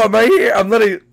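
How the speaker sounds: normal talking voice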